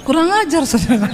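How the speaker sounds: mock outrage dissolving into laughter; laughing. Real, not acted. Preacher laughing to the congregation at the rich man's insult. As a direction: laugh off the cheeky remark.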